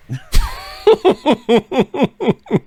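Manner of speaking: a big high burst of real laughter. Real, not acted. Recalling dad's Big C diet joke, real loud laugh.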